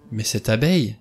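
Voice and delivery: plain reading voice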